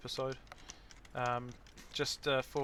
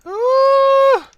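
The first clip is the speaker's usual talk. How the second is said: high squealing moan; 26 dB louder than usual. Real, not acted. Sneeze agony.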